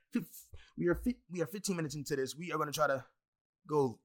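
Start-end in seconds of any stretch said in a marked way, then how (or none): none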